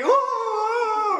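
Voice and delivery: high drawn-out imitated wailing; hammed-up sobbing ooh sounds; mimicking her ugly crying, stretched and high; mock ugly cry. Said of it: "She does the ugly cry".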